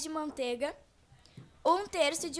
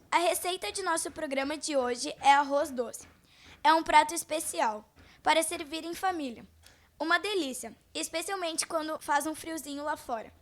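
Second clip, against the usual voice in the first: ordinary talk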